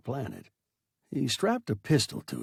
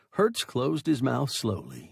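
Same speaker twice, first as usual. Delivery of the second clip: ordinary delivery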